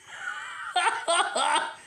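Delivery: high-pitched burst of laughter. Real, not acted. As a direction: laugh high at your own joke. Laughing at own joke.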